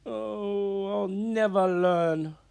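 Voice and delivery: high, loud, slow howl of despair. Acted. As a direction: wail it out in misery. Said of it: "howled Big Anthony".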